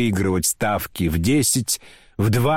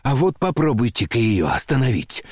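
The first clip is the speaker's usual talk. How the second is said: ordinary delivery, nothing marked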